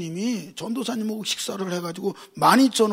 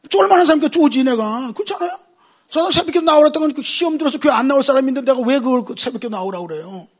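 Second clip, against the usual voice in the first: high, loud rapid-fire preacher voice; heated preaching; 7 semitones higher, 9 dB louder than usual. Acted. Pastor scolding congregation, loud heated preaching. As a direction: hammer the point at the crowd.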